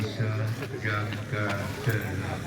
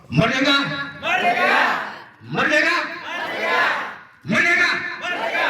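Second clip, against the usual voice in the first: much higher, louder rallying shout; rally speech; 6 dB louder than usual. Real, not acted. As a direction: shout it for the crowd to echo. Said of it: "Semua menirukan".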